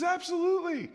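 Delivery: very high, delighted yes; eager yes. Retold or imitated. Hezekiah eagerly agrees to the Babylonians' visit, very high. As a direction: jump at the offer.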